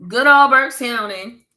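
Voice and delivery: loud and slow, with pride; hometown shout-out. Real, not acted. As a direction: belt out the county name proudly.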